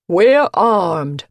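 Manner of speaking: loud warning shout; yelled. A narrator's reading. Henry warning an unseen attacker, loud shout. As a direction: bark out a warning. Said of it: "Henry yelled".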